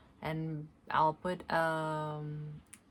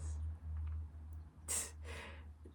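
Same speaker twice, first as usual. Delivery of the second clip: silent shaking laugh; 8 dB quieter than usual. Real, not acted.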